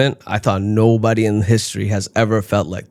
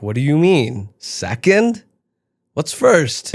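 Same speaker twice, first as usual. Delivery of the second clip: mock outrage; 5 semitones higher, slower than usual. Outraged at God's stats.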